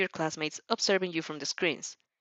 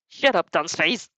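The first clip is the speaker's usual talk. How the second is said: scoffing snap; 3 dB louder, slower than usual. Acted. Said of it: "Bakugo scoffs".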